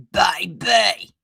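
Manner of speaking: loud, strained character voice; throat-straining impersonation. Acted. Host trying an impersonation of Rowena, straining. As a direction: push out a mimicked catchphrase from the throat. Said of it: "that hurt my throat".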